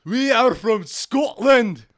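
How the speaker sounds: high, loud, slow Scottish attempt; Scottish accent. Co-host taking the accent challenge, exaggerated Scottish. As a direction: belt out a stretched Scottish accent. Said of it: "chance to do a good accent".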